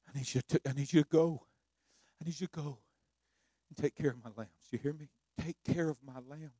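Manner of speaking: soft, low, fatherly urging; tender Jesus. Acted. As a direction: press him gently to go. Jesus charges Peter.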